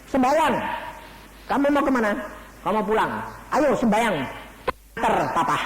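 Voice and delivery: father pressing his dying son to pray; urgent coaxing. Retold or imitated. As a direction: push the boy to pray.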